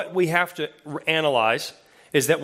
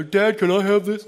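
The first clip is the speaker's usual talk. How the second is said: put-on older kid asking; 5 semitones higher than usual. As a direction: look him in the eye and ask. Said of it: "Notice the voice changed".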